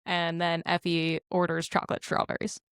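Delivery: normal talking voice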